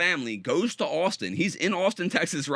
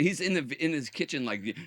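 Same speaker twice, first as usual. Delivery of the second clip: usual speaking voice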